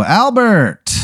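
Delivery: high, drawn-out greeting call; hailing a friend. Acted. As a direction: call out his name like an old pal. Greeting favorite employee on arrival.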